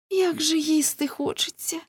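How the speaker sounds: put-on very high girl's plaintive cry; Неле. A narrator's reading. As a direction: exclaim it high, aching with hunger. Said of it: "вигукнула Неле".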